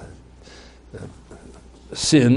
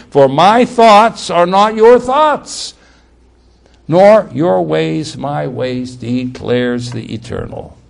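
scripture reading; 5 dB louder, slower than usual. Acted. Preacher reads God's words from Isaiah, slow scripture declamation. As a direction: proclaim the verse slowly, easing down at the end.

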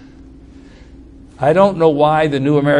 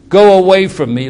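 loud, higher acted cry to be left; Peter's plea; 5 semitones higher, 7 dB louder than usual. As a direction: cry out as one unworthy. Peter overwhelmed at Jesus' knees.

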